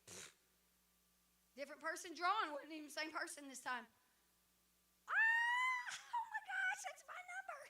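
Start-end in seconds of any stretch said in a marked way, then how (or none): 5.1-7.6 very high squealing shriek; excited winner's scream. Retold or imitated. Raffle winner hearing her number called, excited screaming. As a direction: scream it, then squeal through the words. Acts out winning the draw again.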